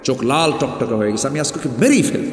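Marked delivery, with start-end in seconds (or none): 1.3-2.2 mock outrage rising to a high-pitched cry; retold fury, pitch leaping on the accusation; enraged father, retold. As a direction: act out a furious father's threat, climbing in pitch. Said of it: "এত ক্ষেপে গেছেন".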